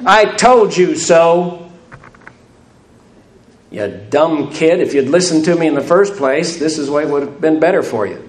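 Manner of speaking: a hammed-up know-it-all dad; mock scolding father. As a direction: scold like a smug parent who was right. Mocking scornful father in sermon.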